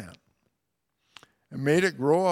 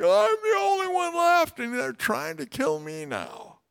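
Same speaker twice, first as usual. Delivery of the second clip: much higher, whinier mock wail; whiny self-pity; 14 semitones higher, 3 dB louder than usual. Preacher mocks Elijah whining to God, squeaky pity-party wail. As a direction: wail it like a pouting kid at his own pity party. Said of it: "having a pity party".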